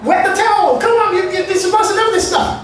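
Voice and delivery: high, loud scolding; exasperated shouted scolding; retold shouting. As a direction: yell at the clueless newcomers. Workers scolding them.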